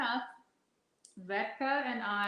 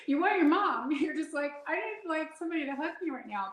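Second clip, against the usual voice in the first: laughing, needy; 7 semitones higher, faster than usual. Retold or imitated. Wanting her mommy, laughing.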